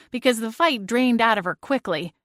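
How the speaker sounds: ordinary delivery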